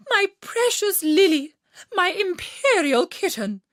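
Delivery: high, loud, gushing queen. A narrator's reading. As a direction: fawn over the kitten in a high queen voice.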